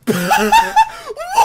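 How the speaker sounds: very high, loud burst of laughter; explosive laughter. Real, not acted. Laughs at the punchline.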